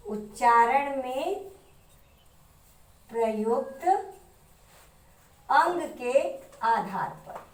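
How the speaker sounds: slowly, phrase by phrase with long pauses; slow dictation. Shown as a demonstration. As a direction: dictate it for students to write down. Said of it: "heading repeated for dictation". (धीरे-धीरे, रुक-रुक कर लिखवाते हुए)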